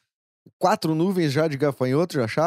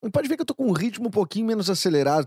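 usual speaking voice

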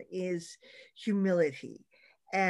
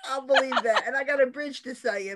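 sarcastic; 5 semitones higher, 5 dB louder than usual. Real, not acted. Mocking the man's obvious false claim.

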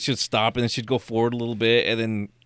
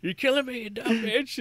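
mock high whine; 11 semitones higher than usual. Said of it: "quoting old man again".

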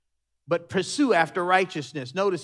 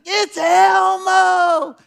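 high, slow, loud squeal of delight; a thrilled little boy shouting at the door; excited child's shout; 11 dB louder, much slower than usual. Retold or imitated. Son greeting Elmo at the door, loud high squeal.

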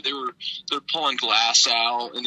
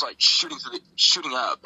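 ordinary delivery

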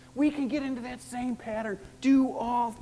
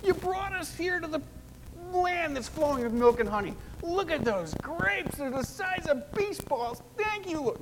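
high, bright mock-grateful voice; mock praise; 8 semitones higher than usual. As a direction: gush with pretend thanks. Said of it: "And they praised God".

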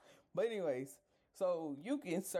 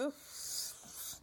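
breathy exasperated sigh; 10 semitones higher, 6 dB quieter than usual. Real, not acted.

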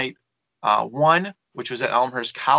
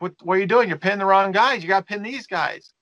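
higher-pitched, mildly raised retold yell; 6 semitones higher than usual. Manager yelling at Hawk over pinning the wrong guys, mock yell. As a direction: imitate yelling out in protest mid-match. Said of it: "I yell at Hawk".

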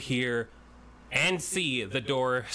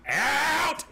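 shouted out; 13 semitones higher, 5 dB louder than usual. Acted. Guard blurts out.

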